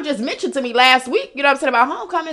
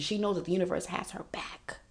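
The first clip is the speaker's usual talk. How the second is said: hushed, softer affirmation; whisper; 4 semitones lower, 10 dB quieter than usual. Real, not acted. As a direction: whisper it like a secret truth. Soft affirming aside.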